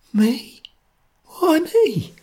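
small, very high scared voice; faint, frightened question. A narrator's reading. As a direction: squeak it faintly, appalled.

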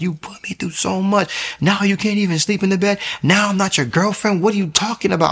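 high-pitched imitation of an angry girlfriend; cursing someone out. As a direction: mimic her outraged tirade way up high. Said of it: "she starts cursing me out".

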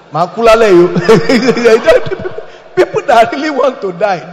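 loud laugh breaking through; laughing loudly. Real, not acted. Preacher to congregation, laughing loudly. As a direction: laugh it out at the absurdity. Laughing at fake suicide caller.

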